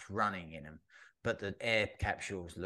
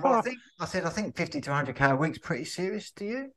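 mock indignant retort; 9 semitones higher, 5 dB louder than usual. Retort to the condescending salesman.

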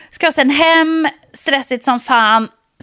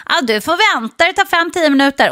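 garbage man; 6 semitones higher than usual. Retold or imitated. Garbage man answers her.

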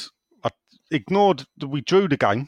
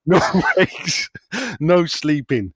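put-on American accent; 3 semitones higher, 3 dB louder, slower than usual. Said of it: "I can't do American".

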